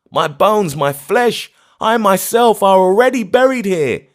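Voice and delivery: high, loud frantic refusal; shrieking refusal. Acted. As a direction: refuse to leave the graves, shrieking. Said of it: "he cried with a shriek".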